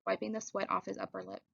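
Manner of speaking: plain reading voice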